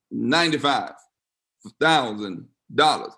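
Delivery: each word set apart, dollars dropped low. Real, not acted. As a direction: let the sum sink in. Pastor repeats the amount for effect.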